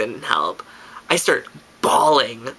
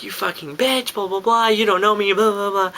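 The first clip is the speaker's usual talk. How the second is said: retold ranting; 6 semitones higher than usual. Drunk speaker calling the girl names, imitated angry rant. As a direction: hurl names back in a held-down, high voice. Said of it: "calling her every single name".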